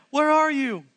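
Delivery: high, loud call across the garden. Acted. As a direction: call out like searching for someone. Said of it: "the Lord God called to the man".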